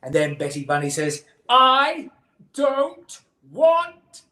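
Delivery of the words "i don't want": put-on high, loud, drawn-out child wail; hammed-up howl of refusal; very dramatic. A narrator's reading. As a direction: build a melodramatic tantrum word by word. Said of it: "We've gotten very dramatic".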